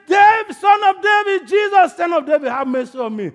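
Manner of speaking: full-voice high, desperate call; shouting. Shown as a demonstration. Preacher showing how Bartimaeus called on God, shouting. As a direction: shout it out hard, easing slightly at the end. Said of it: "he, he shouted".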